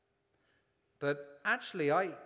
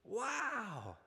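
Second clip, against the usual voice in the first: put-on high hushed awestruck boy; breathless wow; 8 semitones higher, 6 dB quieter than usual. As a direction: gasp it out, almost speechless. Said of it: "The boy was almost speechless".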